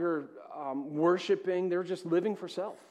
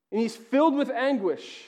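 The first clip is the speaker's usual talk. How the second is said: loud, higher, urgent sermon climax; impassioned preaching; 7 semitones higher, 9 dB louder than usual. Acted. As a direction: build the desperation, full voice. Preaching at full force.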